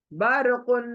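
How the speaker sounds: बरकुन with a thick र; heavy ra. Shown as a demonstration. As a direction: say बरकुन, र full. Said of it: "र मोटा". (बरकुन में र मोटा)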